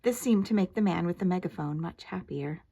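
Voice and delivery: plain reading voice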